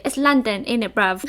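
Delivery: mock London accent; tossed-off London slang voice; British impression. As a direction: play up a Cockney British accent. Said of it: "time for my British impression".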